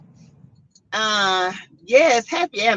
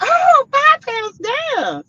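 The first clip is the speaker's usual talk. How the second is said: slow, much higher, savoring each word; delighted at the scale; 3 dB louder, much slower than usual. Retold or imitated. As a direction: relive the happy surprise on the scale. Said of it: "I was like".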